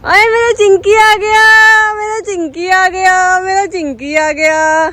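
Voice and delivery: slow, high coo that settles down. Real, not acted. Vlogger greets the arriving puppy, slow doting coo. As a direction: coo over the puppy lovingly. Baby talk greeting dog.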